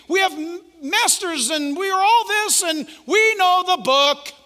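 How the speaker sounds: high, loud haughty boast; smug Pharisees. Acted. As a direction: brag about degrees with contempt. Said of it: "the Pharisees and the lawyers".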